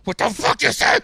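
high, loud snap back; furious retort. Retold or imitated. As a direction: fire it back at the coach, enraged. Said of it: "I lose it".

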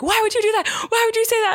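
high-pitched mock panic; retold alarmed protest, way up high; terrified husband. Imitating husband scared by a pregnancy misunderstanding, high and panicked. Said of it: "I really terrified Eric".

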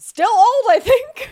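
high and loud through a laugh; laughing retort. Real, not acted. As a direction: toss it off, cracking up. Joking reply, laughing.

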